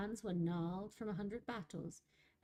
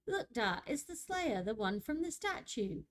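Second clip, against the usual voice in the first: put-on excited girl; 5 semitones higher, 4 dB louder than usual. A narrator's reading. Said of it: "declared the wide-eyed girl".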